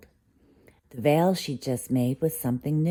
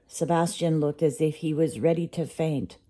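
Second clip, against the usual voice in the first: ordinary delivery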